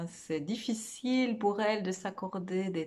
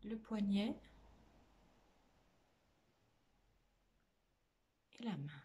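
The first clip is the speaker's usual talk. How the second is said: guided meditation; 4 dB quieter than usual. Real, not acted. Meditation teacher guiding listeners through a body scan, soft guided meditation. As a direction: lead them gently inward, phrase by phrase, leaving lots of space.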